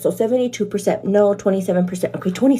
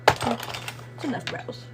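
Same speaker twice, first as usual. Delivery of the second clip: whisper; 7 dB quieter, much slower than usual. Acted. Repeated slowly in a whisper.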